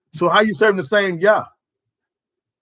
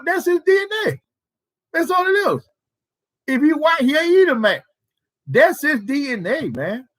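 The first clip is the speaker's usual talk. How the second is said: rising insistence; 9 semitones higher than usual. Real, not acted. Preacher's pitch climbs insisting.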